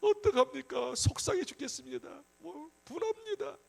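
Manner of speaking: high-pitched mock lament to God; acted upset prayer. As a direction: pour out hurt in a whining plea. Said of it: "막 기도하는 거예요".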